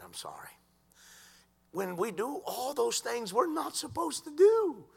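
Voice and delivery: ordinary talk, nothing marked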